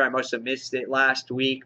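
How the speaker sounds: ordinary talk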